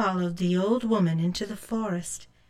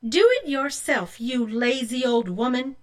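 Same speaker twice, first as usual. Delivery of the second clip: sassy higher voice, talking back; a hint of a bratty girl snapping; rude retort; 4 semitones higher than usual. Rose refusing the old woman, rude and sassy.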